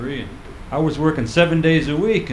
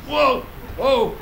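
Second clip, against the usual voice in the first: mock alarm; 10 semitones higher than usual. Riding bike seat.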